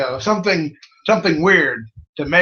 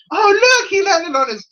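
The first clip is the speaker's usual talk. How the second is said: laughing mock pity; 13 semitones higher, 4 dB louder than usual. Laughing mock-pity about the wrestler throw.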